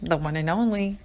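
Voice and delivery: announcer intro. Acted. Podcast intro announcer greeting.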